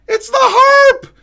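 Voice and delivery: very high, slow shout of "harp"; host shrieking the surprising answer to chat; shouted reveal. Real, not acted. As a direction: yell it in disbelief.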